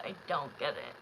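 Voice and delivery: I don't get it dropped low and soft, to herself; quiet, low repeat. Real, not acted. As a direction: mutter it again, deflated. Repeats complaint, then drops.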